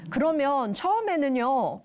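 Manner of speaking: ordinary talk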